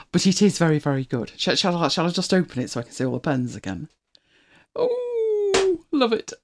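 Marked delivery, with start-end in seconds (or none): 4.7-6.3 delighted coo. Real, not acted. Delighted seeing the pens.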